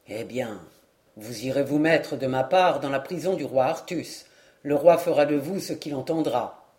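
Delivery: a small man checking a rival, low and steady; the dwarf warning. Acted. Dwarf to the eager knight, low calm warning.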